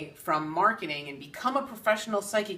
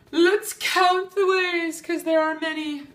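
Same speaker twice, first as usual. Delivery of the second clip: much higher, louder, slow grand announcement; dramatic flourish; 10 semitones higher, 6 dB louder than usual. Acted. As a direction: proclaim it like a showman. Dramatic showy list intro.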